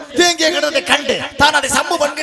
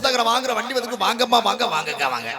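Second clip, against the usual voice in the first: singsong street hawker. Acted. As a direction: cry your wares to the housewives. Calls out goods like hawker.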